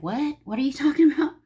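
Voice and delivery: playful disbelieving what; mock bafflement. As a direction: act thrown by a strange word. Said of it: "I'm like".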